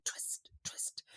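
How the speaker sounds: slow, voiceless whisper; whispered sound effect. A narrator's reading. Narrator voices the scuffle, whispered twisting sounds. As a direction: whisper the words as effortful twisting.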